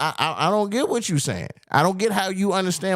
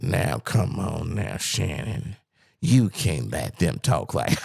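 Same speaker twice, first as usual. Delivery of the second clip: Cat Williams; 11 semitones lower than usual. Retold or imitated. Host mimics how the comedian talked in that interview, low interview voice. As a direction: imitate his offstage talk, dropped low. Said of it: "How he talked in that interview?".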